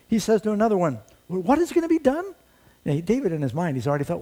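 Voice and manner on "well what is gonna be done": put-on much higher, eager young voice pressing the soldiers; a boy pushing for an answer; eager demand. Young David urging the soldiers, eager and high.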